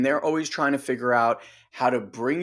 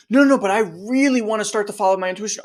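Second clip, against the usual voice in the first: higher, louder, fast insistence; pleading self; 6 semitones higher, 4 dB louder, faster than usual. Retold or imitated. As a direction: push the wish through quickly. Self pleads to inner part.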